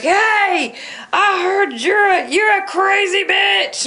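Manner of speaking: teasing yell in a put-on voice; yelled character voice. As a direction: call it out from across the parking lot. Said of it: "yelling across the parking lot in character voices".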